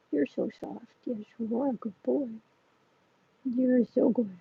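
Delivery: soft, tender pet talk; cooing to a cat. Real, not acted. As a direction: coo softly to the kitty.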